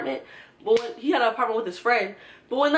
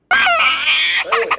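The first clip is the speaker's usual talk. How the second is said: exasperated outburst; 9 semitones higher, 8 dB louder, faster than usual. Real, not acted. Frustration over the car note.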